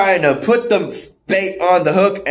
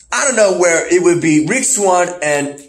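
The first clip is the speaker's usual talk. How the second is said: ordinary talk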